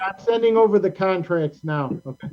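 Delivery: ordinary talk, nothing marked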